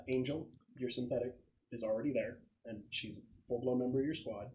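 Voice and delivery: ordinary delivery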